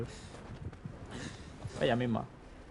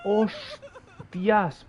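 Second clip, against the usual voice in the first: shocked exclamation; 5 semitones higher than usual. Real, not acted. Shocking death scene in game.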